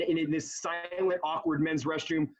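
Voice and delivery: ordinary talk, nothing marked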